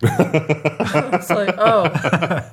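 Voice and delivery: amused, words riding on laughter; chuckling as you play along; laughing. Real, not acted. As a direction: laugh through the mock realization. Laughs while joining the mock quote.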